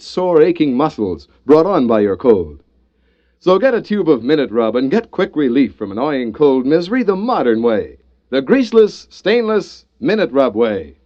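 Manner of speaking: ordinary delivery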